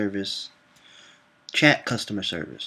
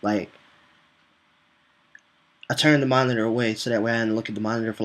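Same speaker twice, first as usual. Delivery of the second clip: ordinary delivery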